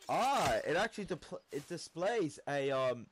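usual speaking voice

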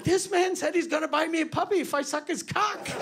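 put-on high, bright kid voice; innocent kid tattling. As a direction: play the sweet victim to the guard. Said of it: "I was like".

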